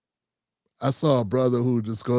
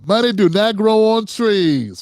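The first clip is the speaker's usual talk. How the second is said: grandma's voice; 6 semitones higher, 5 dB louder, slower than usual. Retold or imitated.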